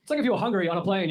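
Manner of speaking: usual speaking voice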